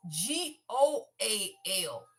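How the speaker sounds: spelling it out slowly, letter by letter; G O A L said slow and low, each letter apart; spelled out. Shown as a demonstration. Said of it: "not G O L D".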